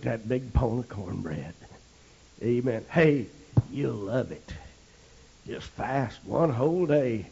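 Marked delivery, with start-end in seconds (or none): none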